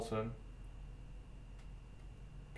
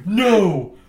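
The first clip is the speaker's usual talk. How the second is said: loud refusal; 6 semitones higher, 11 dB louder than usual. Retold or imitated. Friend refusing, retold loudly.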